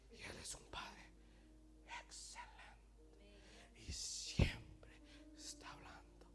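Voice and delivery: hushed, slow whisper; intimate whisper. Shown as a demonstration. Preacher describing the Father who speaks, whispered. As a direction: let each phrase out softly. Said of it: "susurrando es algo íntimo".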